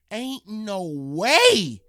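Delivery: slow and loud, stretching every word; can't accept it, drag it out; drawn-out disbelief. Retold or imitated. German soldier after seeing all six guns ruined, slow stunned disbelief.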